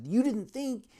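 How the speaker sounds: loud, high, incredulous mock scolding; retold riot act. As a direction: throw it back as an outraged officer. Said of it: "read him the riot act".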